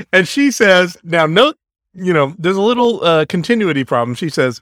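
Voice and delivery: ordinary talk, nothing marked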